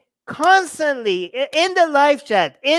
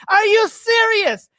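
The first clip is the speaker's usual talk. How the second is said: higher, slower disbelief; stunned, pitched up and drawn out; incredulous; 7 semitones higher, slower than usual. Real, not acted. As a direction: rise up in pure disbelief.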